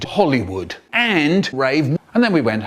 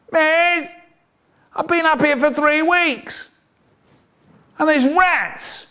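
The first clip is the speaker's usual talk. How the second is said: put-on whiny pleading kid; 13 semitones higher than usual. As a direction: beg the teacher like a stuck little kid. Voicing trapped kid pleading.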